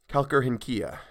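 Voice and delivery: ordinary delivery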